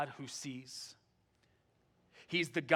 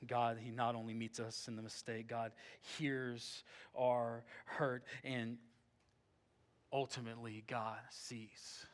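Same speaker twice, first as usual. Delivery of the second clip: hushed preaching; 5 semitones lower, 10 dB quieter than usual. Acted. Pastor gently comforts hurting listeners, soft and hushed. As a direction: bring the room down to a tender near-whisper.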